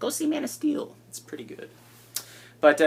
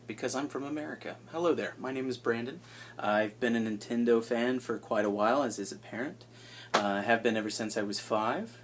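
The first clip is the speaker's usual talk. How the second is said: ordinary delivery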